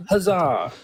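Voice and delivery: a hammed-up old-timey hurrah; mock cheer. Cheering that God is no longer needed, mock triumph.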